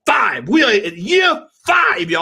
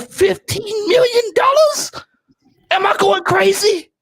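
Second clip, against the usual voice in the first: exasperated disbelief; 6 semitones higher than usual. Real, not acted. Fan pleading with the panel, cracked exasperated disbelief. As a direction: voice jumping up in outrage. Exasperated rant about prices.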